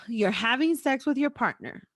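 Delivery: ordinary talk, nothing marked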